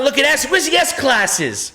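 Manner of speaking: high, loud imitation of blustering customers; mock-shout it, stretching the end; loud mobster wannabes. Salesman imitating Italian guys in the showroom, loud and high. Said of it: "loud as shit".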